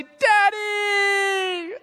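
put-on high, loud, drawn-out child's squeal; excited little daughters. Father imitating his girls greeting him, excited shout. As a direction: shout it like a kid running to a parent. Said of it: "jump up in my arms and say".

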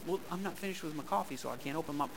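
soft, sheepish aside; mock coffee drinker. Pastor mimics a congregant clinging to coffee, softly. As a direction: mumble the excuse with coffee in hand. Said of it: "coffee-drinker excuse".

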